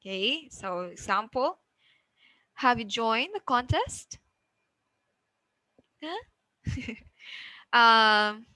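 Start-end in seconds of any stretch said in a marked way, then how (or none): 2.6-4.2 "contest" climbs at the end; rising intonation. Shown as a demonstration. Teacher gives a yes-no example, rising intonation. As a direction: let "contest" lift up as a yes-no question. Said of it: "we have the rising intonation".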